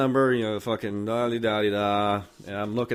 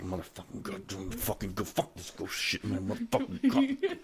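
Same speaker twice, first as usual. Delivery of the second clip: muttered cussing; 2 semitones lower, 8 dB quieter than usual. Retold or imitated. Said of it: "cussing the whole way".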